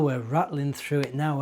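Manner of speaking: usual speaking voice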